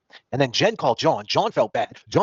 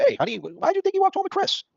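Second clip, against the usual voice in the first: high mock wife voice. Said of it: "Matty M ... springs up".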